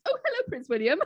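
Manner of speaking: high, surprised hello to the prince; startled greeting. Retold or imitated. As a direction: answer the call in mild shock. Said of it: "You're on the other end".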